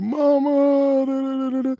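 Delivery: high wailing mock sob to mom; mock whining cry. Heartbroken son calling his mom, high mock wail. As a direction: whimper it like a heartbroken kid.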